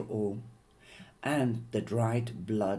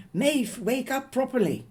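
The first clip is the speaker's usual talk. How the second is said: high, loud urgent call; mother urgently calling her daughter awake; urgent wake-up; 12 semitones higher, 5 dB louder than usual. Acted. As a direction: jolt her out of the nightmare.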